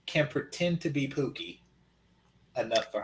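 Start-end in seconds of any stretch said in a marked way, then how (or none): none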